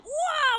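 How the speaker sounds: high-pitched imitated child's wail; loud mock crying "waah"; mock tantrum wail.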